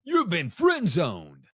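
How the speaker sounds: slow announcer boom; "You've been friend zoned" like a game show buzzer; button announcer voice. Pretend. Imagined show button announcing to guests, slow. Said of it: "a button that I can push that says".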